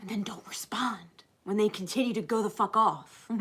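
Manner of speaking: breathy whisper; whispered aside. Acted. Speaker whispering advice to viewers. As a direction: drop to a whisper. Aside after acted speech.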